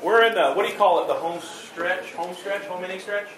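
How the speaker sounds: normal talking voice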